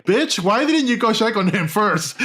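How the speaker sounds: mock outraged yell. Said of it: "I was like".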